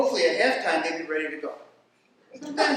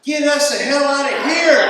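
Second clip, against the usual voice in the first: loud, high desperate shout; 8 semitones higher, 13 dB louder than usual. Retold or imitated. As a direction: yell it to escape. Doctor and baby shout together.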